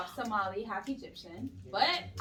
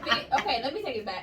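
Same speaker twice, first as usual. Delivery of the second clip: normal talking voice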